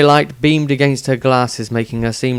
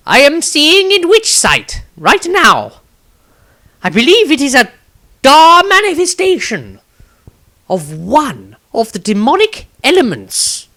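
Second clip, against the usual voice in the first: entranced wizard; 6 dB louder than usual. Acted. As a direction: speak as if seeing beyond the world. Wizard in Witch Sight.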